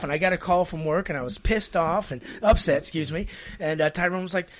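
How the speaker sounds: usual speaking voice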